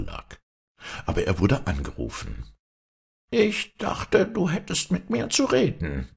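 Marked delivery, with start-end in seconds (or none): 3.3-6.1 very high, squawky goblin voice; squawking kobold. A narrator's reading. Kobold calling after the young farmer: high squawking voice. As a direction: squawk it like a spiteful little kobold. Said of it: "die Quäkstimme des Kobolds".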